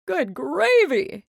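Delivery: loud slow sputter; mayor choking on pie. A narrator's reading. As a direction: choke the words out. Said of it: "he sputtered".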